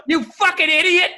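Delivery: high, loud exasperated jab; hammed-up yell of contempt; mock furious insult. Wife insults husband over the swing, mock furious insult. Wife berating him in retold fight.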